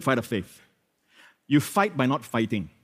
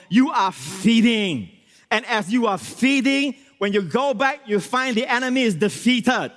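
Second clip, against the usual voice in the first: high-pitched, driving sermon delivery; impassioned preaching; 8 semitones higher, 3 dB louder than usual. Real, not acted. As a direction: preach it with rising fervour. Preaches at full force.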